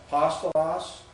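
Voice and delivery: Apostolos, accented on the third syllable from the end; stress third from last. Shown as a demonstration. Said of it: "third from the last syllable".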